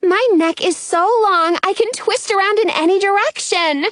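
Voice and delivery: high, gleeful exclamation; excited cry. A narrator's reading. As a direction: show off with delight. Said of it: "Robin cries".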